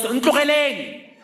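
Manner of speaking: imitating mother, high and loud, a slowed "Ntlogeleng!"; mother's yell. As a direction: shout it at the car out the window, mock-fierce. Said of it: "and yells".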